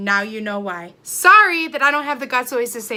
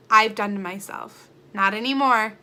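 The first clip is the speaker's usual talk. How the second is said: normal talking voice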